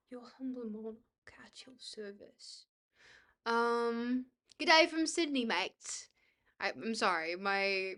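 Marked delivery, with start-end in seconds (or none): 0.1-2.7 whispered, courtly humility; hushed monarch. Acted. Streamer reads a monarch's greeting to chat, hushed and courtly. 4.6-6.1 amateur Aussie greeting, starting high; bad Australian accent. Reading a Sydney viewer's greeting, bad Australian accent. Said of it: "my Australian accent is really bad".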